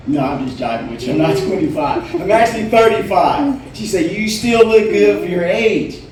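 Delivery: ordinary talk, nothing marked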